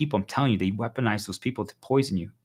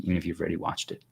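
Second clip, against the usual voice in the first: ordinary talk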